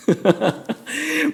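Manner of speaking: breathy high laugh. Real, not acted. Preacher laughs at the English version of the coach, light laugh. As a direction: laugh at the joke.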